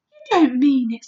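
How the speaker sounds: loud, startled disbelief; shocked cry. A narrator's reading. Girl reacts to giant's screaming plants, loud shocked cry. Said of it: "Sophie cried".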